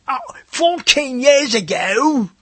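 a hammed-up English stage voice, high and slow; mock English accent. Stage actor steps out before the curtain, mock English accent. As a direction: play a stagey English narrator announcing the flashback, slowly. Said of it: "that's the way all the English people speak".

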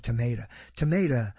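ordinary talk

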